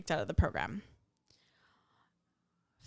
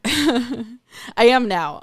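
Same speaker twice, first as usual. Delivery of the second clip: laughing; 5 semitones higher, 9 dB louder, much slower than usual. Real, not acted. Laughing admission of being a crier.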